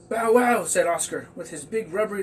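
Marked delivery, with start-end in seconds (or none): 0.1-0.6 loud playful woof; dog bark. Acted. Oscar the dog barks at the girl.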